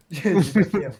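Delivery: hammed-up laughing whine; laughing complaint. Real, not acted. Repeats his complaint louder.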